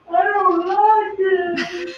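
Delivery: high, slow fake wail; mock crying. Mocking partner's complaint with a hammed-up high sob.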